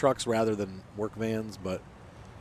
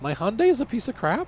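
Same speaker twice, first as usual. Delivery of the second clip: indignant disbelief; 13 semitones higher, 7 dB louder than usual. Real, not acted. Defending his car to the caller.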